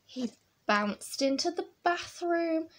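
usual speaking voice

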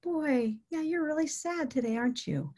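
soft, subdued commiseration; sympathetic mirroring. Shown as a demonstration. Caregiver meeting a sad person where they are, sympathetic. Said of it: "meet them where they are".

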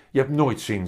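a bit cross. A narrator's reading. Mama scolds Willem for never wanting outside, a bit cross. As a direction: chide with a touch of anger. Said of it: "zegt mama een beetje boos".

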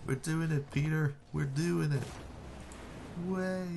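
high, thrilled cheering; excited high cheer. Real, not acted. Team nearly scoring.

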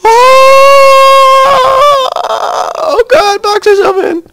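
high frantic yell; panicked shout. Real, not acted. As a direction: yell the warning in terror. Desperate shouting under attack.

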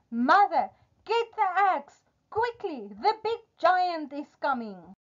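put-on high, urgent boy calling mother. Jack needs the axe as giant comes, urgent. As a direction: call for help, pitch leaping up.

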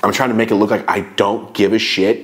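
ordinary talk, nothing marked

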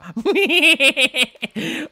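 a real giggle; giggling laugh. Real, not acted. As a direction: laugh at what he admitted. Laughs at own confession.

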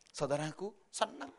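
ordinary delivery

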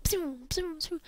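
high zapping shots; imitated ice gun. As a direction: make light laser noises.

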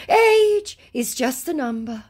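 high, slow smarmy line; mock scammer voice. Scammer's cheesy reply, mocked high and slow. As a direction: drawl it like a cheesy suitor.

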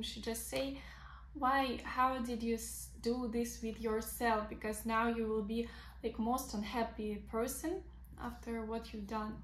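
ordinary talk, nothing marked

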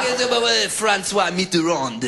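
high French babble into a drawn-out French name; exaggerated French imitation; mock French noises. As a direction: babble fake French, then stretch the final word. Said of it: "I make French noises and then drop in".

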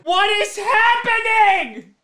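high, drawn-out exclamation; anguished fan outcry; disbelieving cry. Real, not acted. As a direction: wail it in total disbelief. Second interception, fan in disbelief.

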